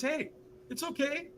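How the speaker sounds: high, hushed acted reassurance; soothing backing-off. Stranger reassures the refusing speaker, soothing. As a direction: soften right down to calm someone.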